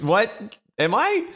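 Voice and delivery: incredulous retold "what, am I"; retold surprise. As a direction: act stunned, voice jumping up high. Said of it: "And I went".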